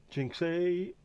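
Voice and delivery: high squeaky call. Acted. Calling a viewer's name.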